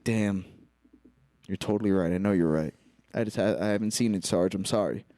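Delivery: low, subdued mock apology; chastened. Retold cop conceding to his sergeant, low and chastened. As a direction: give in sheepishly, pitched well down.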